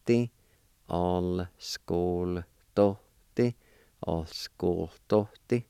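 "ascoltotti" drawn out very slowly, each sound stretched; very slow model pronunciation. Shown as a demonstration. Teacher modelling the word for grant, very slow. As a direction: say "ascoltotti" slowly so learners can copy it. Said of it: "Number five is the word grant".

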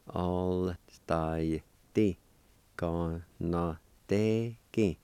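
"All style T. Gon no te ki" in long separate syllables; slow syllable-by-syllable. Shown as a demonstration. Teacher putting the parts together, slow syllable-by-syllable. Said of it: "practice and go slow".

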